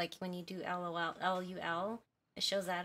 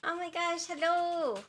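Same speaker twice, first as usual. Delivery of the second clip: high, loud excited hello; excited greeting; 8 semitones higher, 6 dB louder, slower than usual. Real, not acted. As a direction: greet them with delight.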